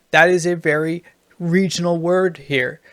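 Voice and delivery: usual speaking voice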